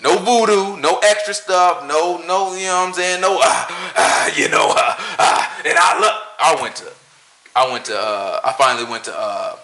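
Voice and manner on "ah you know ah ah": mock preacher huffing. Mimicking preacher noises.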